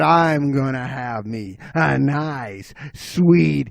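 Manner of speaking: slow, hungry savoring; relishing bobcat. Acted. Bobcat wanting dessert first, slow hungry drawl. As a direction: stretch it out with relish. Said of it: "He said".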